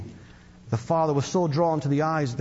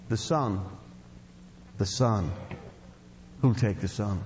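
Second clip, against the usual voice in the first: quiet pleading; 9 semitones lower, 6 dB quieter, slower than usual. Acted. Auctioneer pleads with the crowd.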